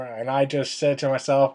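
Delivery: ordinary talk, nothing marked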